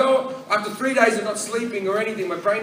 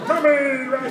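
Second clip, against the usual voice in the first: high, put-on chirpy call; hammed-up perky baby talk; fake lively; 8 semitones higher, 3 dB louder than usual. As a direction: pretend cheeriness, pitched up. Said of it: "make him look more lively".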